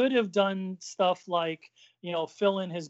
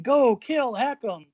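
mock cheering; 6 semitones higher, 4 dB louder than usual. Chuck cheering at wrestling.

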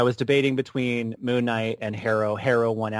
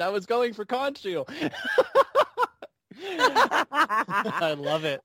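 higher-pitched, breaking up into laughter; laughing; 9 semitones higher, slower than usual. Real, not acted. Co-host whose top pick was stolen, laughing. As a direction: crack up while getting the words out.

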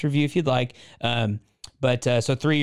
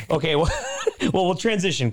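laughing; 5 semitones higher than usual. Real, not acted. Laughing at own joke.

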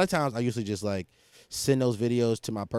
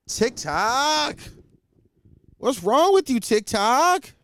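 exasperated; 11 semitones higher, 4 dB louder, much slower than usual. Real, not acted. Scolding the app.

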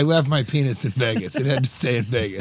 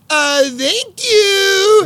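very high, loud, long-held "thank you"; catchphrase cadence; 8 dB louder, much slower than usual. Retold or imitated. Imitating Kate's catchphrase for the co-host, high drawn-out cadence. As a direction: belt it out high and stretch the last word way out. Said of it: "Kate's been doing the".

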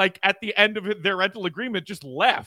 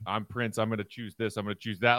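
imitating [name]; 8 semitones lower, 6 dB quieter than usual. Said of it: "very particular".

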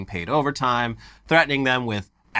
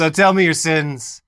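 fake priest voice; 3 semitones higher, 5 dB louder than usual. Hosts act out priest hearing confession.

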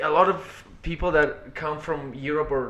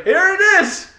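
eager dealer voice; 7 dB louder than usual. Retold or imitated. Imitating dealers handing over.

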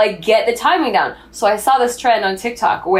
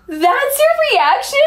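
very high, slow disbelief; incredulous; slower than usual. Retold or imitated. Naked wife to her panicked husband, incredulous. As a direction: draw it out, high and affronted.

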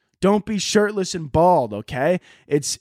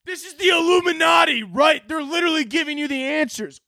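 mocking conspiracy poster; 11 semitones higher than usual. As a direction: yell it like a frantic online truther. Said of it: "people will be like".

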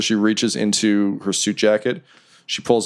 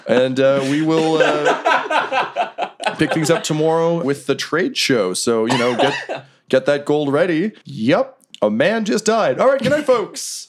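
higher-pitched emcee sign-off; lilting announcer, rising on the farewell; Remy; 6 semitones higher, slower than usual. Acted. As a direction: wrap up the show for the crowd. Remy signs off to crowd.

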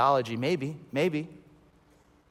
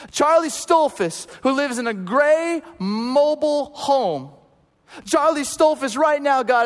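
fervent prophetic prayer; 8 semitones higher, 4 dB louder than usual. Retold or imitated. Praying man prophesies over Tony, high and loud. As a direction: proclaim it like a charged revival prayer.

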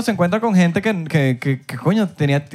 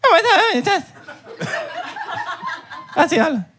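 very high-pitched processed voice; tiny squeaky effected voice, played for laughs. Shown as a demonstration. Host showing off his microphone effect, high altered voice. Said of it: "le voy a quitar el efecto al mío".

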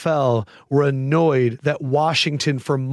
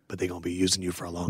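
a quick, much softer and lower sly aside; knowing wink; 10 semitones lower, 9 dB quieter, much faster than usual. Pretend. Veteran winks at his rookie self, a quick hushed aside. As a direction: tip yourself off with a hushed wink. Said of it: "would you look at yourself and wink and be like".

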